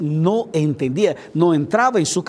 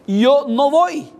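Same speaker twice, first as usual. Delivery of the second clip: slow, higher stubborn no; flat refusal; 8 semitones higher, slower than usual. Retold or imitated. Moses refusing God's call, slow stubborn no. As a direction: dig in your heels and refuse. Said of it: "porque la frase fue".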